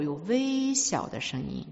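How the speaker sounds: soft, slow, drawn-out words; tiny voice. Shown as a demonstration. Illustrates God's tiny voice. (轻而缓慢的微小声音)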